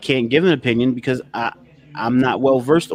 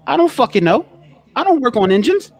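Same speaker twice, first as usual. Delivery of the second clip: higher and louder, fed up; exasperated outburst; 10 semitones higher, 4 dB louder than usual. Real, not acted. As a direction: blurt it out, totally fed up. Exasperated at being asked.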